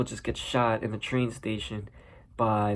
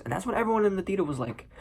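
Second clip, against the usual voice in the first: ordinary talk, nothing marked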